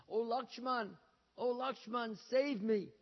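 high, loud dying call; shouted cry for help. Acted. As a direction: call out loudly as if desperate to be saved. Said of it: "he shouted out".

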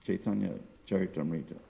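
usual speaking voice

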